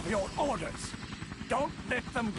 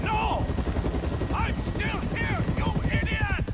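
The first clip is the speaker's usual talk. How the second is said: furious shout; 12 semitones higher than usual. Acted. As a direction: scream it at useless minions. Villain raging at failing troops.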